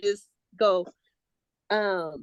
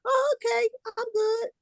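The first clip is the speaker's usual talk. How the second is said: much higher, content little voice; satisfied inner voice; 13 semitones higher than usual. Retold or imitated. Coach voices resistance finally satisfied, high and content. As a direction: chirp it, pleased and settled. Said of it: "It'll go".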